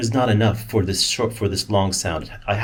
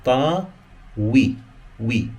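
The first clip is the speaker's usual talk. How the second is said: normal talking voice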